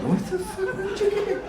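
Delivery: high squeaky disbelief, laughing; shocked squeal. Retold or imitated. Son squeals at his mom over his name, high and shocked.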